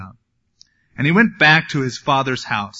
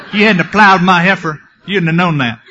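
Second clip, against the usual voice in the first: put-on southern accent; 3 semitones higher, 6 dB louder than usual. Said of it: "Sounds kind of southern".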